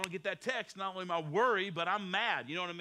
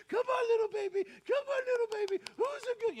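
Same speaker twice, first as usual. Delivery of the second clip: put-on baby talk; 11 semitones higher than usual. Parent cooing at a baby like a pet, high-pitched baby talk. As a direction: coo at the baby like a puppy. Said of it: "you talk to 'em the same way".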